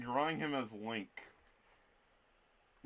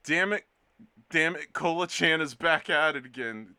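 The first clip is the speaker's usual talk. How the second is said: higher, louder hammed-up frustration; mock outrage; 5 semitones higher, 9 dB louder than usual. Teasing a returning chatter with mock outrage. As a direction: pretend to be fed up.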